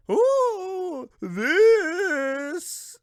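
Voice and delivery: high ghostly wail. Acted. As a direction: moan like a spooky ghost. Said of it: "spooky ghost voice".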